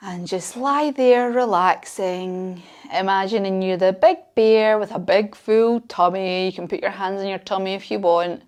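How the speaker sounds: put-on soft bedtime-style relaxation for kids lying on mats; guided relaxation. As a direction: guide the children to rest, gently and quietly. Leads relaxation at end.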